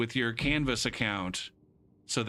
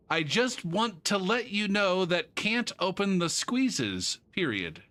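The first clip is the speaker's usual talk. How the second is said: higher, slow mock-reading of the email; reading student email; 7 semitones higher, slower than usual. Teacher reading out a student's email, slow and higher. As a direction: read the odd phrasing deliberately. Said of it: "He's sending me an email".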